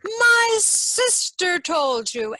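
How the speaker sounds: slow, high and loud in a strange voice; crying out in anger. A narrator's reading. Green Knight crying out angrily that his sister betrayed him. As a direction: spit it out, furious the secret is out. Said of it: "cried the Green Knight".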